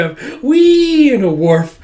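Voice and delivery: long, high, loud wheee; playful squeal. Retold or imitated. Child running around with the dog, playful squeal. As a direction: squeal it like a kid at play.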